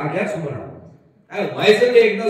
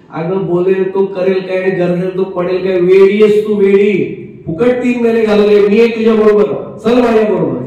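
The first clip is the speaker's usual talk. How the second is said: much louder, rousing friend; loud rebuke; 10 dB louder than usual. Retold or imitated. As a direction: shake her out of it loudly. Friend rebukes and urges the wife.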